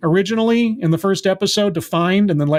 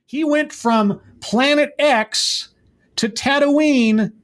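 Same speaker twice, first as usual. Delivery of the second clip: slow dramatic emphasis; 7 semitones higher, slower than usual. Real, not acted. Mocking an instant hyperspace jump.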